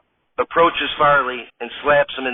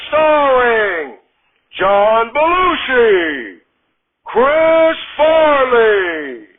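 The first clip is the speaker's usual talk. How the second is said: high, slow, drawn-out TV show intro; hammed-up announcer, higher pitch and long held vowels; 10 semitones higher, 5 dB louder, much slower than usual. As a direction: stretch each name out like a big live announcer.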